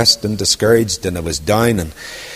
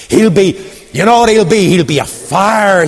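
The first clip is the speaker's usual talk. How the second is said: blaze it out to the crowd; fiery prophet; 7 semitones higher, 4 dB louder than usual. Acted. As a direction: prophet warning of fire. John the Baptist proclaiming.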